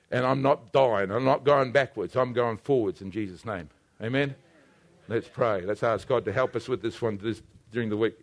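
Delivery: low, soft pastoral delivery; quiet prayerful close. Real, not acted. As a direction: bring the room down toward prayer. Winds down into prayer.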